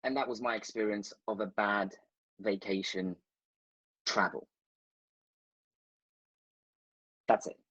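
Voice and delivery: low wrap-up broken by long pauses; dramatic pauses. Real, not acted. Storyteller ending his bad vacation tale, with dramatic pauses. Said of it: "it was a dramatic pause for effect".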